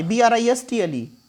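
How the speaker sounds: ordinary talk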